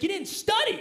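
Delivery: a teasing God scolding the student. Retold or imitated. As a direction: answer like an exasperated God. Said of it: "He's like".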